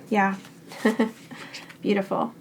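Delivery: normal talking voice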